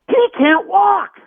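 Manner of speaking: high, slow, incredulous yelling at the producer; exasperated outburst. Retold or imitated. Fighter to the producer, high slow outraged disbelief. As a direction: spell out each word in disbelief.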